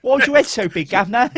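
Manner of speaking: high-pitched mock cockney; hammed-up cockney urchin from a film; cheeky cockney, high and laughing. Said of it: "everybody talks like a cockney".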